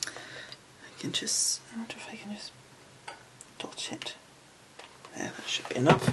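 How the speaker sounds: soft whisper while concentrating. Real, not acted. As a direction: whisper under your breath, focused. Concentrating on a fiddly glue dot.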